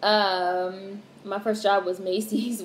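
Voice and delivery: normal talking voice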